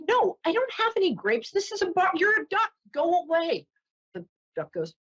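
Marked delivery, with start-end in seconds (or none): 0.1-3.7 high-pitched mock exasperation; annoyed bartender. Bartender sending off a duck, high and exasperated. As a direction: protest high, dismissing a silly customer. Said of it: "And the bartender says".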